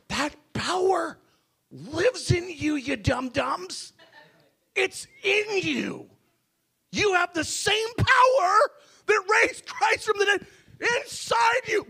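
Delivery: starts slow and high, then climbs to a full shout; God speaking, building to a shout. Acted. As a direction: build from a slow, teasing voice to a roaring proclamation. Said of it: "He says".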